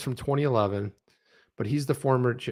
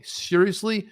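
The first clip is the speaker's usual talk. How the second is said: ordinary delivery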